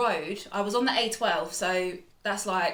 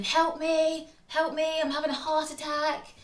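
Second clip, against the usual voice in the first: retold scream for help; 8 semitones higher than usual. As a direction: yell it out of the car window, terrified. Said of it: "I was screaming".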